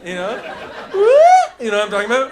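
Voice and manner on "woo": loud high whoop of joy; a big excited woo; joyful woo. Pretend. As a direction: whoop like you're leaping. Jumping, joyful yell.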